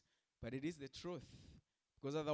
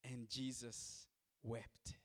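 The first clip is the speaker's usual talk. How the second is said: very slow and much softer; And Jesus wept, spoken hushed and slow; hushed scripture quote; 4 semitones lower, 9 dB quieter, much slower than usual. Acted. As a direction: let it fall almost to nothing, slowly. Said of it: "the Bible says".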